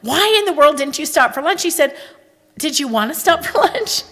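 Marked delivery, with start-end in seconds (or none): 0.0-1.6 higher, a touch louder retold complaint; mock angry wife. Retold quarrel with husband. 2.6-4.0 high, laughing husband voice; imitating puzzled husband.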